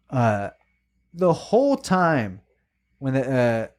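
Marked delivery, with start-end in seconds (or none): 1.1-2.4 "whole" stretched high and slow; emphasis on whole. Real, not acted. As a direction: lean hard into "the whole time", drawn out. Ranting about annoying woman.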